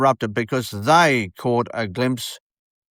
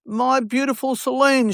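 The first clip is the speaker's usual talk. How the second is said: a high, mock-thrilled wife's voice; excited gush; 10 semitones higher than usual. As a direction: mimic an overjoyed fan on the phone. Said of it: "she said".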